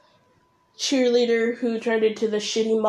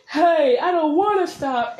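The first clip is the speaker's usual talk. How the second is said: raised, whining-back retort, first step of a quarrel; mock argument yell; 5 semitones higher, 4 dB louder than usual. Shown as a demonstration. Creator plays the partner answering back, moderate mock yell. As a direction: answer back with a higher pitch. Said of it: "It's that kind of yelling".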